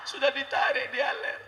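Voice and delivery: put-on very high, wailing child voice; hammed-up tearful wail; mock crying daughter. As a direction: whine to mama in fake tears. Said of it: "anak pulang nangis".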